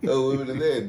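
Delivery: loud laughter breaking through the words; cracking up while telling it; laughing. Real, not acted. Laughing while retelling game.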